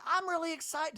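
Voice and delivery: imitating Heather. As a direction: parody a cast member's bubbly interview line. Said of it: "Heather in a talking head goes".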